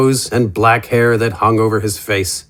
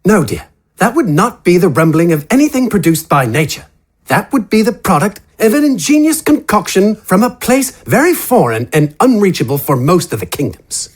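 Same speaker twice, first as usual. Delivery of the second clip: lilting, higher character voice, a touch condescending; an eccentric gentleman explaining; Wither's voice; 6 semitones higher than usual. Acted.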